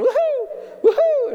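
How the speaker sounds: high whoops with a laugh mixed in; laughing hoots. Retold or imitated. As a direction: hoot and holler through a laugh. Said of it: "Hooting and hollering".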